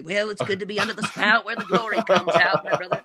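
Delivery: lightly voiced Southern preacher, laughing through it; dad's preaching voice. Son imitating his preacher dad, a touch of it. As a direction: slip into his dad's pulpit cadence. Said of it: "I got a little bit of my dad in me".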